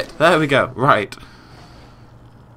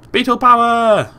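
high, loud, drawn-out battle cry; triumphant shout, stretched out; 10 semitones higher, 7 dB louder, slower than usual. Real, not acted. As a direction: call out the attack like a superhero move. Calling out an attack in game.

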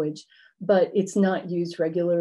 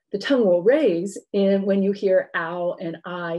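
ordinary talk, nothing marked

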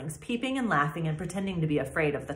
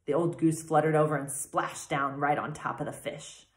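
usual speaking voice

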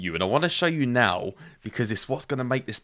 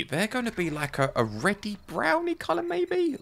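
high pitch; 11 semitones higher than usual. Real, not acted. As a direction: lift way up into a squeaky register. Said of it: "I went all high pitch there".

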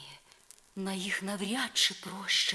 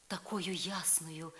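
ordinary talk, nothing marked

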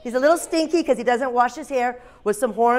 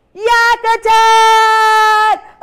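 long drawn-out high shout; playful attack cry; 11 semitones higher, 10 dB louder, much slower than usual. Pretend. Teacher sets children running past the yak, playful shouted attack cry. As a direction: holler it loud and high, stretching each word. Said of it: "When I say yak attack".

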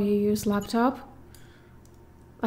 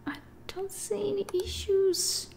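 whispering; 8 semitones higher, 9 dB quieter than usual. Real, not acted. Tense search in horror game.

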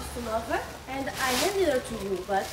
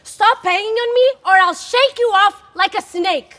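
loud, high angry yell; raging shout at a clinging girl; furious shout; 5 semitones higher, 13 dB louder than usual. Acted. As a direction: shout her off you in rage. Shaking Hermia off furiously.

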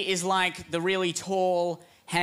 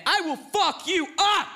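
much higher bully shout; threatening yell; 12 semitones higher than usual. Acted. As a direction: scream the threat in his face. America threatens at full force.

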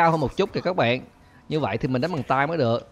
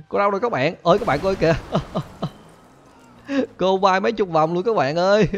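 thrilled, pitched-up commentary on a prank; gleeful excitement; 6 semitones higher than usual. Real, not acted. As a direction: crow with delight, laughter breaking in. Watching teacher get blown sky high.

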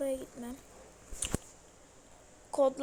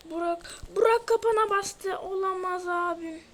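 higher, louder alarmed cry; excited alarm; 4 semitones higher, 4 dB louder than usual. Real, not acted. Gamer yelling friend stepped in trap, alarmed. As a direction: panic as your friend hits the trap.

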